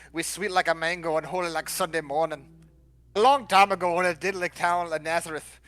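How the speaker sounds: mock Jamaican storyteller accent. Podcast guest attempts the Christmas story from the Bible, Jamaican accent. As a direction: tell it in an amateur, lilting Jamaican accent. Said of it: "but in a Jamaican accent".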